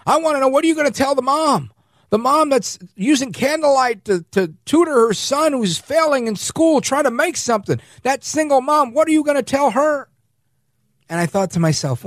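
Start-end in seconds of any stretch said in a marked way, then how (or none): 0.1-10.1 high, rising mock yell; hammed-up indignant yell; retold yell. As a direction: imitate him yelling at a reporter. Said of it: "He yelled at a reporter".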